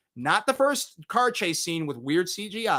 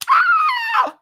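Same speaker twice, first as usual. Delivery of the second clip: very high, loud, held scream; mock horror scream; 8 dB louder than usual. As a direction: let out a hammed-up shriek. Mock scream after synopsis reveal.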